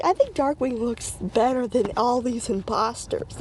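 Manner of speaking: ordinary delivery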